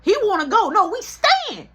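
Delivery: put-on high, loud kid squabble; mock kid argument. Brother insisting they stay, high and loud. Kids arguing over leaving.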